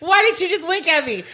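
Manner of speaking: high, laughing mock outrage; mock alarmed. Retelling the movie, she demands why he winked, high and laughing. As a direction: play her freaking out over the wink. Acting the woman confronting the wink.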